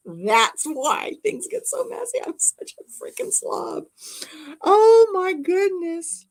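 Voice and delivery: loud, laugh-filled confession; laughing hard. Real, not acted. Reading a viewer's joke about her messy craft room, laughing. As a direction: laugh through the words.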